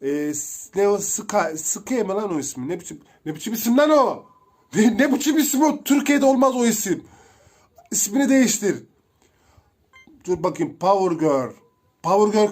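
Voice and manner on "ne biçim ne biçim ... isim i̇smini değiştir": loud, high, scandalised cry at a silly name; indignant mock outrage. Real, not acted. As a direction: act outraged at the name, hammed up. Mocking a weird player name. (yüksek sesle, alaycı bir öfkeyle)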